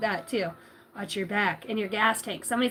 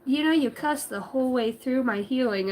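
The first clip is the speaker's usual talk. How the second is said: ordinary delivery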